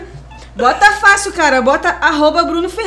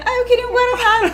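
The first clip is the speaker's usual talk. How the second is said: naive self imitation; 10 semitones higher than usual. Retells own naive order.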